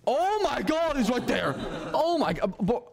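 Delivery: high startled yelp of panic; panicking gamer. Real, not acted. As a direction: gasp it out as the monster appears. Player sees monster suddenly.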